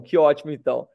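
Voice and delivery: normal talking voice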